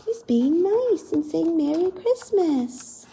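gentle whisper. Acted. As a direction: breathe it out kindly. Showing the bear being gentle.

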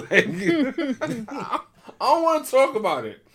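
laughing through the words; can't stop laughing while talking. Real, not acted. Laughing at his own story.

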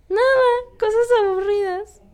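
high, loud whining reply; mock whiny protest. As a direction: whine it like a teen caught out. Teen answering mother, loud.

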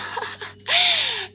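a burst of high, loud laughter; real laughter, half crying; high laughing cackle. Real, not acted. Laughing at her own crying.

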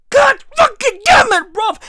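high, loud curse; furious shout. Real, not acted. Vlogger exploding at the goat story, furious shout. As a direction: blow up at the absurd news. Outburst during goat rant.

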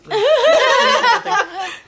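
loud, high cackling laugh; real high-pitched laughing fit; burst of laughter. Real, not acted. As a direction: crack up loudly. Laughing at the joke.

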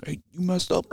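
high, sharp put-on bark; mock chewing out. Marine chewing someone out, high mock bark. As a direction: chew out a junior Marine, a touch hammed-up. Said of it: "You choose".

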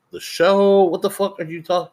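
can't believe the news, shrieking; shocked disbelief. Retold or imitated.

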